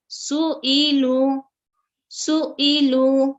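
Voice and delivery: सुईलु drawn out slowly, each sound clear; slow recitation demo. Shown as a demonstration. Teacher reading सुईलु slowly to students. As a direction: spell out सुईलु slowly for a class. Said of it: "उसी तरह next".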